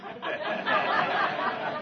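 soft breathy chuckle; laugh. Real, not acted. Preacher laughing at his own story, soft chuckle. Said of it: "laughs at own story".

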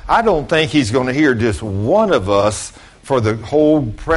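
usual speaking voice

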